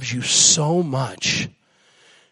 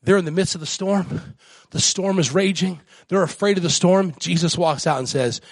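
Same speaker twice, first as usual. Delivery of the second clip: usual speaking voice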